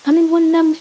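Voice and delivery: ordinary delivery, nothing marked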